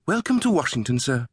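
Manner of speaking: high, lilting British greeting; chirpy high British official; sing-song British woman. A narrator's reading. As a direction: greet a guest brightly high, British accent. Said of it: "a sing-song British voice".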